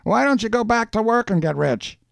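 high-pitched character voice; teasing postman. A narrator's reading. Postman teasing Schultz in story.

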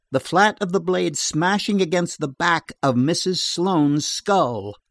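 plain reading voice

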